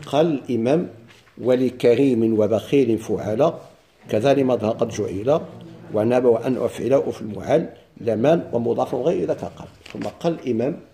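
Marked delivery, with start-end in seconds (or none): 1.4-9.7 measured, chanted reading of the verses; verse recitation. Acted. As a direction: recite the poem line by line.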